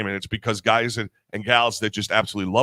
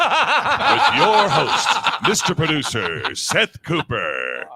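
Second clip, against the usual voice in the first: slow, stretched-out host intro; announcer voice; 2 semitones higher, 4 dB louder, much slower than usual. Acted. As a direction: draw out the host's name like a big reveal. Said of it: "I get to use my announcer voice".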